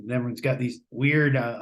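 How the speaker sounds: ordinary talk, nothing marked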